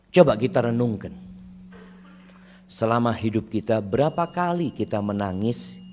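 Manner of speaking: usual speaking voice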